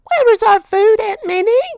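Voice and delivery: nephews crying out. A narrator's reading. Hungry nephews demanding food from Aunt Minnie, high whiny cry. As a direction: whine for dinner. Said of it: "they cried".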